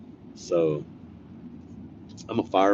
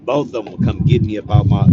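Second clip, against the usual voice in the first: ordinary talk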